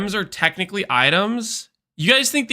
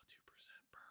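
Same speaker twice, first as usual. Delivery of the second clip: whispering; 43 dB quieter, much faster than usual. Real, not acted. Muttering to himself reading stats.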